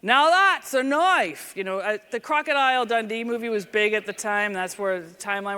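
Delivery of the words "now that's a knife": loud, drawn-out mock Australian accent; Australian accent yell. Cop calling out to a knife-wielding hunter, Australian accent yell. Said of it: "I yell out in my best Australian accent".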